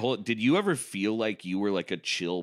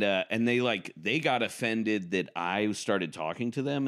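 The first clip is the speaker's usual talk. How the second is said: usual speaking voice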